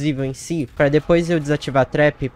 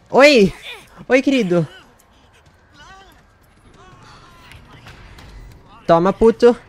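taunting; 11 semitones higher, 9 dB louder than usual. Real, not acted. Taunting the chaser.

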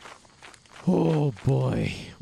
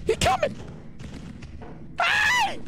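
full real panic scream; terrified scream; slower than usual. Real, not acted. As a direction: scream as it grabs you. Monster attacks, screaming.

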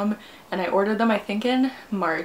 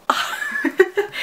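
high, loud burst of delight; excited squeal; 11 semitones higher, 5 dB louder, much faster than usual. Real, not acted. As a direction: squeal it out, overjoyed. Opening long-awaited package.

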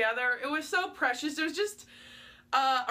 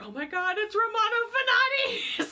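rising to a high, louder squeal on the name; starstruck surprise; 9 semitones higher, 3 dB louder than usual. Retold or imitated. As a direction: gush like spotting a star. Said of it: "And I'm like".

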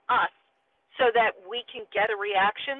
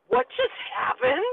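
stunned question; 10 semitones higher than usual. Retold or imitated. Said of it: "we were like".

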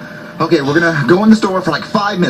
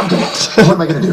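usual speaking voice

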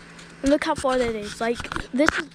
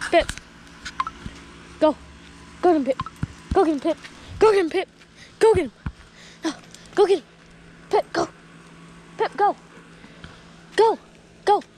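higher, louder urging calls; calling out; 5 semitones higher, 4 dB louder, faster than usual. Real, not acted. Urging Pip across the yard toward dad, calling out. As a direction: push the dog to run, calling.

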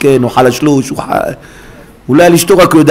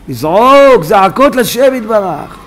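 drawn-out, pleading call to cry out; קריאה נמתחת; 6 semitones higher, slower than usual. Real, not acted. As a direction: stretch the words slowly, pitch lifted. Preacher urges crying out in prayer. (זעקה מתמשכת)

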